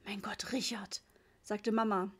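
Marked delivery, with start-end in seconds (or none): 0.0-1.0 whispered "Mein Gott, Richard"; a dismayed whisper to her husband; hushed dismay. A narrator's reading. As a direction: breathe it out in dismay. Mama at the wild sea.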